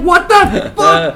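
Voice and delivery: high, slow, appalled "What the fuck?"; stunned disbelief. Retold or imitated. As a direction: stretch it out in mock horror. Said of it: "We're like".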